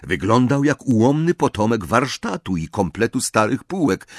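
usual speaking voice

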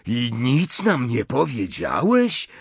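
slow, high, incredulous question; drawn-out offended disbelief; affronted. A narrator's reading. Ron learning Harry kept lessons secret, affronted. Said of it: "żachnął się Ron".

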